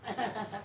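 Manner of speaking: high, soft giggle; light, high-pitched mock laughter; imitated class laughter. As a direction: mimic a class starting to laugh. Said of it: "the class starts laughing".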